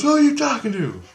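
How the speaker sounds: high, questioning mock-wife voice; imitated wife. Wife catches husband talking in sleep, high imitated voice. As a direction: pitch it way up as a puzzled wife.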